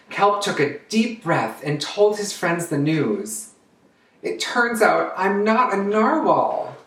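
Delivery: put-on lively storytime narration for kids; storybook reading. Drag queen reads a picture book to children, animated. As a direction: read it out like storytime for little ones.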